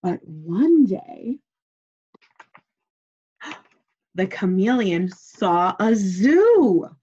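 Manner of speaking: slow, loud build with a gasp; slow suspenseful read-aloud; dramatic storytelling. A narrator's reading. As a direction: draw the children in with a gasp. Dramatic reading, gasp heard.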